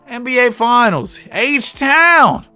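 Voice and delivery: hype shout. Real, not acted.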